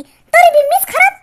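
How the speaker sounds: high, loud and slow, wounded complaint; theatrical self-pity cry; aggrieved wail. Acted. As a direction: whine it out as the wronged one. Grandma complains she's blamed.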